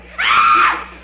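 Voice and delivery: loud shocked shriek; comic scream of surprise; startled yell. Acted. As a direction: let out a sudden cry of alarm. Don't yell.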